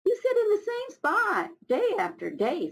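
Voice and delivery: high, light little beetle voice; Spotted Beetle voice. Acted. Spotted Beetle teasing Old Rock in a high voice. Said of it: "said Spotted Beetle".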